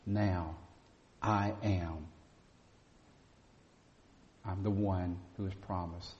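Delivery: ordinary talk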